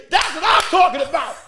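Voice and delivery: loud, high, hyped approval; hyped cheer. Retold or imitated. Guest with the Henny cheering the comic, loud and hyped. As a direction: cheer like you just dropped your drink. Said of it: "The guy with the Henny".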